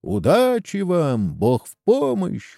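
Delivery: high, slow, drawn-out send-off; lightly voiced chorus of well-wishers; guests in chorus. A narrator's reading. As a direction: wish them well as one crowd. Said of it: "хором ответили гости".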